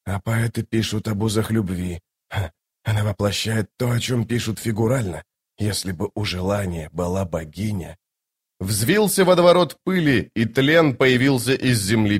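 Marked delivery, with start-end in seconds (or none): 0.1-8.0 soft, subdued musing; lightly voiced Toc, awed. Toc shaken by the near kiss, quiet musing. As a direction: muse quietly to yourself.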